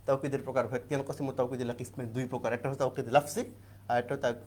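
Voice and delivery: ordinary talk, nothing marked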